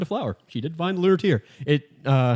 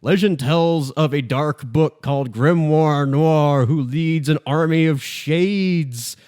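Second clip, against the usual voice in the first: slow, higher, grand storyteller voice; legend telling; 4 semitones higher, slower than usual. Retold or imitated. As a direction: tell it like an ancient epic, drawn out. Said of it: "let me tell you, like, a legend".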